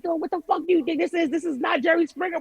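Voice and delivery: high-pitched mock telling-off; retold scolding scream. Host imitating her boss telling her off, mock screaming. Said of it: "like, screams at me".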